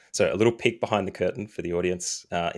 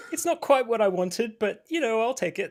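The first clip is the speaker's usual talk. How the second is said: quoting himself in a high put-on voice; lifted, singsong self-quote; mock high voice. Co-host mimicking his own reply to the nickname, high and mock. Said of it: "because I keep saying".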